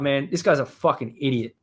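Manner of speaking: ordinary talk, nothing marked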